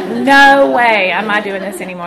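loud, emphatic refusal; emphatic mock protest. Patient's inner answer to doctor, loud mock refusal. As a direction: belt out the opening "no way".

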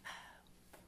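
soft, breathy and uncertain, near a whisper; humbled quiet confession; hushed reply to God. Retold or imitated. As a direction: answer God quietly, half unsure but willing. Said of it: "And I went".

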